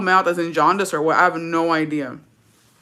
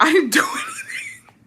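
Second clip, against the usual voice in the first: much higher, laughing protest. Retold or imitated. Telling Jacobo she did nothing, laughing high. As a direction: giggle through your innocence. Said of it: "But I was laughing about it".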